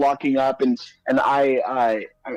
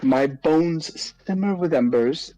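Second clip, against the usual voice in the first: wounded dignity. Acted. Fire-born hero tells Dee Dee he's not okay, wounded solemnity. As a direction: declaim the grievance with measured pauses. Wounded speech about being soaked.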